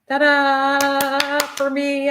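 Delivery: high, slow, drawn-out ta-da; celebrating yourself with a slow high ta-da. Real, not acted. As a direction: sing out a proud little fanfare. Celebrating finished piece.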